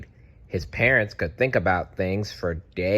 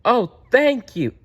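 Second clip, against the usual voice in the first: high, louder burst of thanks; overjoyed child; 6 dB louder than usual. A narrator's reading. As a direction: squeal thanks with delight. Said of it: "Franklin gets his wish".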